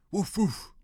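high mock woof woof; imitated dog bark. Narrator imitates the Alsatian barking at the rustling, high mock bark.

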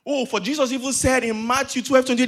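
ordinary delivery

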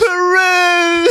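high, drawn-out comic whoop; playful shouted call. Pretend. Trying out new slang on co-host, playful shout. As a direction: holler it long and high.

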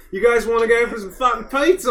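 high, exaggerated mock Australian; Australian accent. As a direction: ask it in a silly Aussie accent. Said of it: "He's doing Australian now".

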